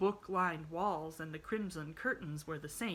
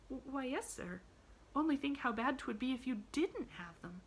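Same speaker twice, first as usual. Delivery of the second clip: put-on hesitant little girl; 8 semitones higher than usual. A narrator's reading. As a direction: answer timidly, stumbling at the start. Child answers the bitter outburst hesitantly.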